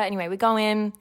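ordinary delivery

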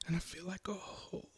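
soft, breathy bewilderment; trembling on the edge of whispering; shaken hush. Acted. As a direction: nearly whisper it, lost and shaken. Shaken after the figure hit him.

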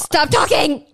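loud, high playful yell; mock outburst. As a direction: cut them off with a playful shout. Cuts off cohost's moving talk.

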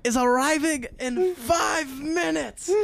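put-on high, slow shouting French accent; screaming French accent. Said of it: "freaking out in a French accent".

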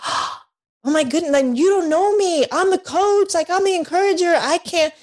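high, rising alarmed protest; shocked protest. Retold or imitated. Answering the counselor who called her depressed, high shocked protest. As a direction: gasp then protest, climbing high. Retold gasp at counselor's verdict.

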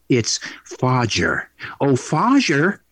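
slow "Fajer" stretched out, Dutch-style; sons' nickname "Fajer" dragged out slowly; drawn-out Fajer. Retold or imitated. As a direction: lean into "Fajer" slowly like Goldmember. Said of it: "that's all my boys call me".

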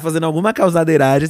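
usual speaking voice

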